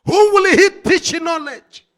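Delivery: WHO flung out loud and high, falling at the end; preaching at full force. Acted. Preacher hurling the question at the congregation, loud. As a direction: cry "Who" high over the crowd.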